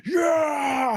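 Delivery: high, loud macho yell; roid rage shout. Retold or imitated. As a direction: roar like a steroid-raged jock. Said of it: "It's like".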